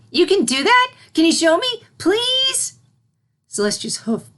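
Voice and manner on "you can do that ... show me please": very high, louder thrilled begging; a child bursting with excitement; excited. Acted. Filly begging Celestia to show levitation, very high and thrilled. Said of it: "The filly's excitement returned".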